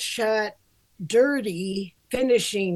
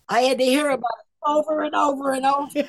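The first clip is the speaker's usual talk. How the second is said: fast, higher, laughing complaint; laughing exasperation; 4 semitones higher, 3 dB louder, much faster than usual. Real, not acted. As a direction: rattle it off through a laugh. Exasperated retelling, laughing at herself.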